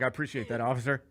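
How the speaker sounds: hushed, deferential appreciation; meek politeness. Retold or imitated. Driver to the officer in real life, meekly polite. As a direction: say it softly, swallowing the argument. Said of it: "No, in real life".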